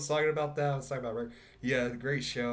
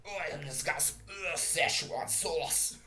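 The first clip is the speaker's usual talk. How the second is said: drunken cartoon impression; 4 semitones higher than usual. Joker asking about cartoons and sauce in drunken Rick voice. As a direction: ramble like a belching drunk, a bit higher.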